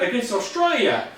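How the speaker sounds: high-pitched New Zealand caricature; New Zealand accent. Acted. Host telling the team about their next match, put-on New Zealand accent. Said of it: "doing my best New Zealand accent".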